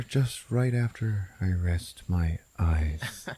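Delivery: slow, fading stoner; slow down as if nodding off; sleepy drawn-out trail into sleep; passing out. Retold or imitated. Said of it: "And he passes out".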